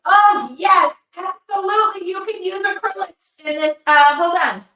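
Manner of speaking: blurting out an excited answer too loudly; excited shouting. Real, not acted. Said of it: "Why am I screaming at you?".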